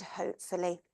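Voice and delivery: ordinary talk, nothing marked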